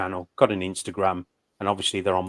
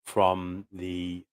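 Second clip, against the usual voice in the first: usual speaking voice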